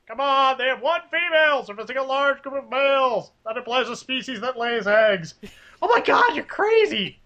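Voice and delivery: high, exasperated nerd voice; nerdy henchman rant. Acted. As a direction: argue the point, high and worked up. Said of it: "the henchmen and Venture Brothers arguing".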